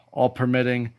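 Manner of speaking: ordinary talk, nothing marked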